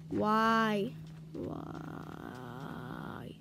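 deflated, sinking to a low drawn-out why; disappointed. Real, not acted. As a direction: let it sag into a low groan. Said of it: "I'm disappointed".

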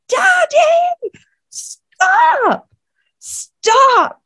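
imitating a little girl's very high, loud scream; high-pitched imitated shriek, each word drawn out; piercing child's scream. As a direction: scream it out like a terrified child pleading. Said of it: "screamed. And it was like this pierce".

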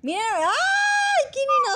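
high, slow drawn-out shriek; excited shriek. Real, not acted. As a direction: shriek the name in delight. Sorpresa al iniciar partida. (grito agudo de sorpresa)

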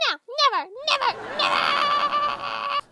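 rising tantrum, last word stretched high; stubborn refusal. Acted. Refusing to come inside, stubborn high whine. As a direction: dig in, climbing to a long whine.

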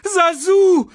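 high, loud cub's scream. A narrator's reading. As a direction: scream for help. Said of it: "schrie Simba". (Simba schreit laut und hoch)

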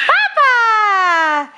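high, loud, drawn-out echo; excited echo. Shown as a demonstration. Parent echoes Elise's word back, high and excited. Cheering the toddler's attempt.

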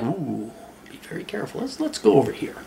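soft, wary mock aside; hushed caution. Speaker acts out spotting trouble with girls, hushed caution. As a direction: drop right down as if backing away carefully.

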